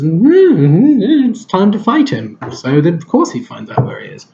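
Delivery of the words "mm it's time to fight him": high, drawn-out mock hum into a line; higher-pitched mock-dramatic announcement; mock hype. Reviewer mocking the film's rush to battle, mock hype.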